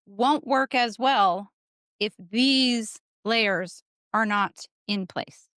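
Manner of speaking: slow and deliberate, word by word; measured, weighty delivery; slow emphasis. Real, not acted. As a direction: lean on each word slowly. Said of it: "so I can make this emphasis".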